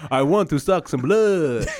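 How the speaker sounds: slow, drawn-out vampire line; hammy Dracula impression. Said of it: "his one-liner will be".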